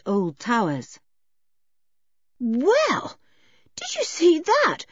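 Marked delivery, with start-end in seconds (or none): 2.4-4.8 put-on higher, astonished girl's voice, slowing on the drop; amazed. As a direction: George can hardly believe what she saw.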